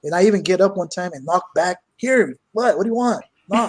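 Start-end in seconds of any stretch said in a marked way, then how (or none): 2.0-3.7 higher, louder annoyed challenge. Retold or imitated. Man calling out to knocking ghost, annoyed shout. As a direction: dare the ghost to answer back.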